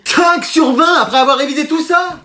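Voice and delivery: high, slow shocked cry; student shocked at his failing grade; outraged disbelief. Acted. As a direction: shout it out in disbelief.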